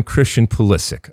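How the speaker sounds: "Pulisic" ending in a hard K, LIS stressed; final K, second-syllable stress. Shown as a demonstration. Host settling the name, "Pulisic" with LIS stressed and a final K. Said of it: "K at the end, an accent on the second syllable".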